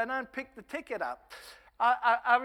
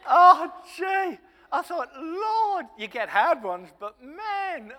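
loud, high-pitched groan to heaven; exasperated; 6 semitones higher, 11 dB louder, slower than usual. Retold or imitated. Evangelist to the Lord about a hard one, exasperated. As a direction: cry out in comic despair.

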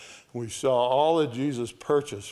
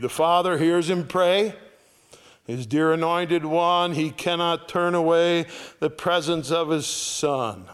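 louder, higher hymn recital; hymn reading; 5 semitones higher, 4 dB louder than usual. Acted. Preacher recites hymn to congregation, raised and loud. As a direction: declaim each line.

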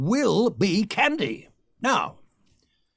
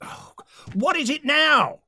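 exasperated; 10 semitones higher than usual. Acted. Exasperated at interruption.

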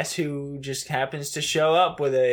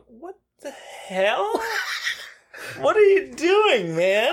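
high, incredulous mock reaction, much higher; baffled what the hell; 12 semitones higher, slower than usual. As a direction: act out a stunned double take. Both reacting to confusing scene.

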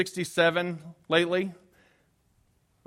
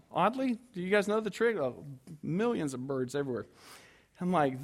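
usual speaking voice

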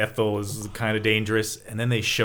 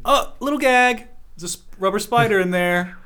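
higher-pitched startled exclamation; mock startled; 10 semitones higher than usual. Podcaster retelling Tommy opening his closet, mock startled. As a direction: play a small jump of surprise. Acts out discovering a gag in closet.

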